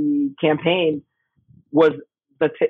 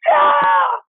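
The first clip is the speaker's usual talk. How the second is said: triumphant yeah; 5 dB louder than usual. Retold or imitated. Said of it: "And she goes".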